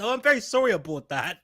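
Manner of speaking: hammed-up Canadian accent; mock-polite Canadian apology; apologetic Canadian. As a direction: apologize profusely with Canadian vowels. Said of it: "No, no, it's a Canadian".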